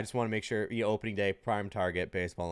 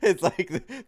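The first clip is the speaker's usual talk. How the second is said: laughing; 13 semitones higher, 7 dB louder, much slower than usual. Real, not acted. Laughing at the trolling story.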